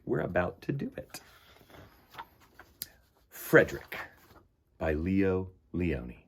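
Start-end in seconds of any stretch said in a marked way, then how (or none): 3.3-6.1 unhurried picture-book narration; storybook reading. Acted. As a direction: read it like story time for little ones. Reads picture book aloud.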